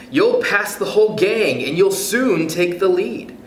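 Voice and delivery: ordinary delivery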